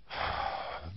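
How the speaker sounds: long, low, deflated sigh; heavy sigh. Shown as a demonstration. Showing the audience low energy with a weary sigh. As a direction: sigh like all energy is gone. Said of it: "than if I start talking like".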